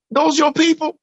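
pitched well up, a curious stranger asking; odd stranger's voice. Retold or imitated. A homeless man asking the visitor about the crime scene, in a high voice.

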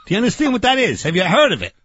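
normal talking voice

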